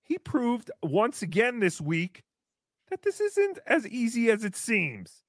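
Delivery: ordinary talk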